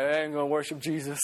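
low, quick mock grumble; back-row rebel. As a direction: play a dismissive rebel, low and hurried. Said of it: "some of the rebels were in the back".

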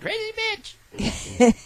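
very high, very slow doll voice; pull string toy. Pretend. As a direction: drag it out like a toy. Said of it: "pull that string".

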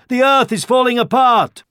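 high, loud alarm; crying out. A narrator's reading. Hares warning the grazing deer, crying out. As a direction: sound the alarm at a high pitch. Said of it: "cried the hares".

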